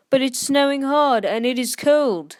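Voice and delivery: protest, not wanting to go; whiny protest. Acted. Henry protests to Sir Topham.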